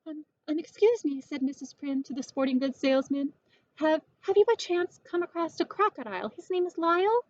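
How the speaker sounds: high, fast, stammering worry; frantic asking. Acted. Missus Prim asking the salesman about Lyle, frantic. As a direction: stumble over the words in a panic.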